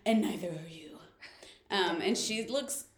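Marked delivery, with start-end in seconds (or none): 0.0-1.0 soft, low near-whisper; understated menacing murmur; hushed retold threat. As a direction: quote it quietly, almost whispered, as a warning. Retold ominous line to captive.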